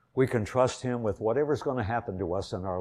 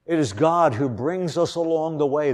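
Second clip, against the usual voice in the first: higher, louder, proclaiming GOD; emphatic preaching; 8 semitones higher, 6 dB louder than usual. Real, not acted. Preacher proclaiming God's guidance to the congregation, loud and high. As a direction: ring it out to the back pews. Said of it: "same line repeated louder".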